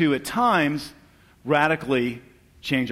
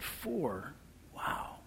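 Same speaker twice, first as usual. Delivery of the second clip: stunned disbelief; 3 semitones higher, 14 dB quieter than usual. Retold or imitated. Stunned reaction to cancer news.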